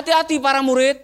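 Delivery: normal talking voice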